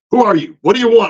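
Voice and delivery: mock snippy who-are-you; sour assistant. Sour assistant snapping at a visitor, mock curt. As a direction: imitate a curt, annoyed receptionist. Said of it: "She's like".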